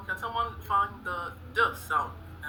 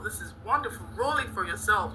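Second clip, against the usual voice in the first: ordinary talk, nothing marked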